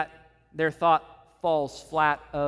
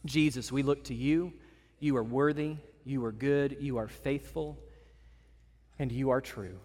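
prayer. Acted. Leads closing prayer.

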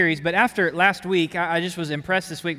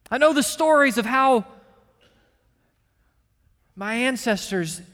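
impassioned preaching; 5 semitones higher than usual. Acted. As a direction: cry it out as David's anguish. Preaching the psalm's lament.

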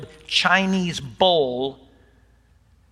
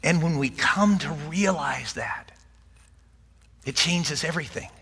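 whisper; 4 dB quieter than usual. Real, not acted. Solemn point after laugh.

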